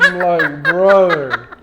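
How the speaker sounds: laughing through the words; real laughter breaking into the line; laughing while retelling the delay. Real, not acted.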